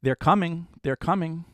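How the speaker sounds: put-on high, urgent little girl whisper-warning; lightly voiced little girl saying they're coming; imitating a little girl. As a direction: mimic a frightened child warning others. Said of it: "the little girl is saying".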